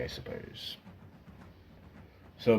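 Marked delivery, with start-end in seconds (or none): 0.0-0.8 breathy whispered afterthought; whispered aside. Acted. British officer grudgingly conceding the war, whispered. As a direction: drop to a resigned whisper. Muttered end of British quote.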